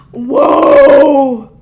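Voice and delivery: loud cry of amazement; shouted whoa. Real, not acted. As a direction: belt out the shock. Disbelief at diet news.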